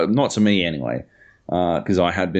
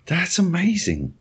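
high, laughing exclamation; amused, pitched up through laughs; real laughter; 10 semitones higher than usual. Real, not acted. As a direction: say it while still cracking up. Still laughing at the tweet.

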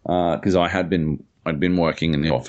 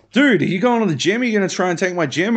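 pitched-up, quick-fire retold shouting; excited retold challenge; 11 semitones higher, faster than usual. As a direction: pester a stranger with excited questions. Retold yelling at stranger on street.

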